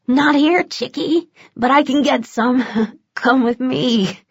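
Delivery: high, giggling wheedle, slowing at the end; a creepy grinning pusher luring a mark; giggly vampire dealer. A narrator's reading. Vampire luring a fake addict, high giggly wheedle.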